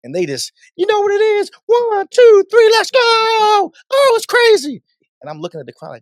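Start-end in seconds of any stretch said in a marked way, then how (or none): 0.8-4.8 high screamed countdown into the mic; over-the-top shouted party hype; super loud hype man. Retold or imitated. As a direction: imitate an MC yelling at a crowd. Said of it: "And it's super loud".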